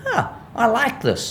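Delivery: high, slow, pleased dog voice; contented dog voice. Retold or imitated. Trainer voicing a rewarded dog, high and slow. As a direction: let the dog savour the reward. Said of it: "The dog goes".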